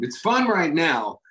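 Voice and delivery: usual speaking voice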